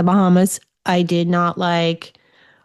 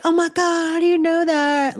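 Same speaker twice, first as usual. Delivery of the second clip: much higher, astonished officer; amazed officer; 10 semitones higher than usual. Retold or imitated. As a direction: imitate a stunned officer. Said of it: "He's like".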